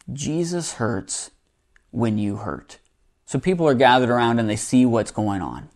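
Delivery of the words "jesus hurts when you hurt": very soft and slow, falling lower; hushed, unhurried pastoral comfort; tender reassurance. Real, not acted. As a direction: console gently, leaving space between phrases. Preacher consoling hurting listeners.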